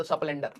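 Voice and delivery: स्प्लेंडर said as os-plender, short vowel slipped in before the sp; स्प्लेंडर का देसी उच्चारण, एस्प्लेंडर; local pronunciation. Shown as a demonstration. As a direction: local Hindi way, esplender for स्प्लेंडर. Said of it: "स्प्लेंडर कहते हैं हमारे यहाँ पे".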